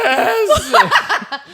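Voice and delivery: very high shouted wail; over-the-top mock cry with laughter; overemotional confession. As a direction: shriek it, imitating a tearful confession. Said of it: "that yes is so amazing".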